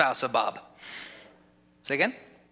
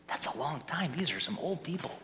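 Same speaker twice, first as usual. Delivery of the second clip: much softer, offhand remark; quiet aside; 9 dB quieter than usual. Real, not acted. Pastor commenting on the long-married couples, hushed aside. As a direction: drop the volume right down, under the roll call. Aside during a roll call.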